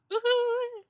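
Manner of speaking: high-pitched whoop; excited cheer. Real, not acted. Player celebrating during the raid, high excited cheer. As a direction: let out a high woohoo.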